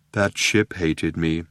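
low, slow dejected robot. A narrator's reading. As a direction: say it like everything is hopeless. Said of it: "he said dejectedly".

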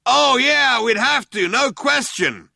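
high, loud yelled agreement; yelling back. A narrator's reading. Second cop agreeing with his partner, yelling back. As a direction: yell it back, stretching the last words. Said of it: "the other one yelled back".

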